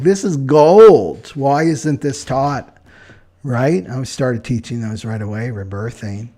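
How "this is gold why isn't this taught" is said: slow and loud, marvelling at a discovery; excited inner exclamation. Retold or imitated. Finding old teachings, she exclaims in slow, loud wonder. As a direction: savour each word like treasure found. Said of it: "I'm like".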